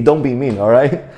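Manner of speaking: ordinary talk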